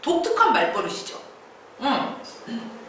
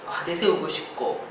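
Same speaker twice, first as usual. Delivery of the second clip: ordinary delivery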